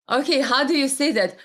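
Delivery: ordinary talk, nothing marked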